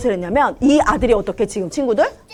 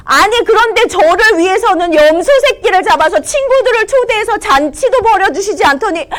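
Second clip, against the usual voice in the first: higher, louder indignant outburst; angry loud son; 8 semitones higher than usual. Acted. Older son resenting the feast for his brother, angry. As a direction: throw the complaint at father, fuming. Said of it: "화가 난 목소리로".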